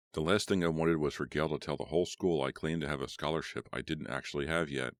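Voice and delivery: plain reading voice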